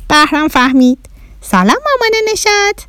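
put-on high, piping alien child; little Martian boy. Bahram greets Neshat's mother, little Martian voice. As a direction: pitch it way up, a small Martian. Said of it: "بهرام کوچولو گفت".

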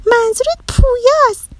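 put-on very high, triumphant child's voice; girl catching on. A narrator's reading. Girl guessing the boy's real name, catching on. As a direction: announce your guess in a high girlish voice.

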